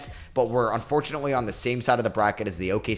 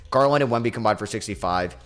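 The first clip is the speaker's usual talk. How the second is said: ordinary talk, nothing marked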